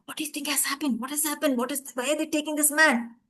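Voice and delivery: usual speaking voice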